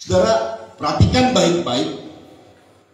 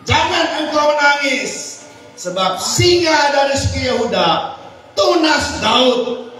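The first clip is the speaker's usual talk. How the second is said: much higher and louder, slow and proclaiming; full force preaching; 12 semitones higher, 5 dB louder than usual. Acted. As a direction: reread the verse with triumphant force. Rereading the verse at full force. (mengulang ayat dengan seruan khotbah penuh semangat)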